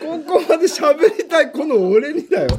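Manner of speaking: high, loud comic protest; indignant high-pitched whine in jest; mock outrage. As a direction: protest loudly, half laughing. Mock outrage at having to talk.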